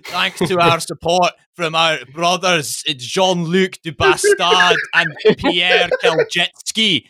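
high, proclaiming Scottish militant; Scottish separatist accent. Acted. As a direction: announce thanks in a hammed-up Scottish accent. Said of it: "imagining a powerful thing, an underground Scottish separatist".

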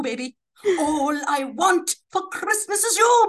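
ordinary talk, nothing marked

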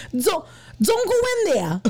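put-on loud, high Boston accent shout. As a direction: yell it in a thick Boston accent. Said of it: "it is a Boston accent".

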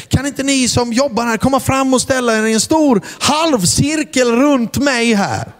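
high announcer voice on the mic; stage announcement. Retold or imitated. Preacher announcing a gift to the club girls. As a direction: address the room like a host. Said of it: "Så sa jag".

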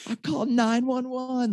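high mock yell; high-pitched frantic yelling; retold scream. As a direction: shout it at the kids who threw the ice. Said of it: "I'm screaming".

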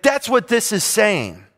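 ordinary talk

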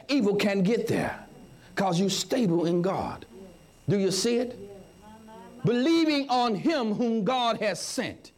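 normal talking voice

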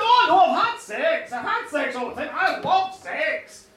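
loud burst of enthusiasm; acted gleeful declaration; eager boasting. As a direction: gush it out, punching the key words. Young woman eagerly corrects the king.

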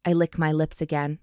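usual speaking voice

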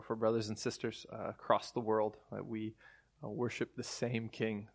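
soft, subdued praying voice; prayer. Real, not acted. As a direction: pray quietly, pausing between phrases. Leads a prayer.

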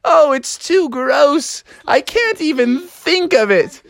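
high, wailing mock dread; hammed-up anxious whining, drawn-out groans; nervous fretting. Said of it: "he was so nervous".

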